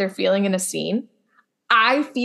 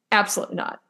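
usual speaking voice

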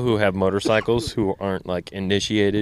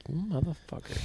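muttered curse; 7 semitones higher, 12 dB quieter than usual. Retold or imitated. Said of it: "And I was like".

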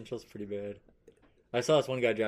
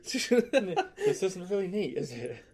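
laughing; 9 semitones higher, 3 dB louder, much slower than usual. Real, not acted. Laughs while teasing Nate.

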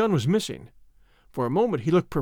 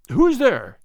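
higher, louder waking challenge; startled call; 5 semitones higher, 5 dB louder than usual. A narrator's reading. Father wakes and calls to whoever is there, startled.

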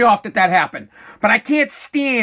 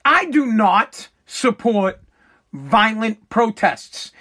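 slow, lower, every word weighed; slow emphatic statement; 3 semitones lower, slower than usual. Real, not acted. Vlogger stating firmly he opposes violent protests, slow and weighty. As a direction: lay it down deliberately, word by word.

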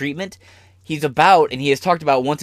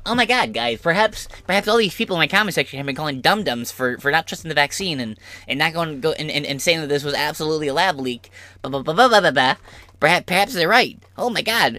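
fast, higher mock-pundit babble; Ben Shapiro; 4 semitones higher, faster than usual. Mocking Ben Shapiro admitting commenters were right, fast. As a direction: rattle it off like a panicked pundit. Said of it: "made Ben Shapiro go".

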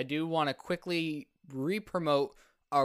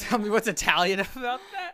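laughing through a loud Italian accent; 8 semitones higher, 5 dB louder than usual. Shown as a demonstration. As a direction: ham up a mafioso accent, breaking up. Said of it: "do a full Italian accent".

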